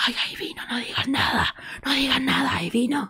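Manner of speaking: imitated conspiratorial stage whisper; theatre whisper. Sol warns the girls she has arrived, stage whisper. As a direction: hiss it behind a hand, meant to be overheard. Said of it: "esos susurros de teatro que hacen".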